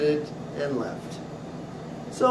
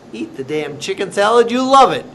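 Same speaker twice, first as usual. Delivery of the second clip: loud, high playful order; bossy command; 4 semitones higher, 8 dB louder than usual. Retold or imitated. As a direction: boss her around with a grin. Owner bossing customer.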